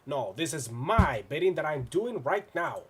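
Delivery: ordinary talk, nothing marked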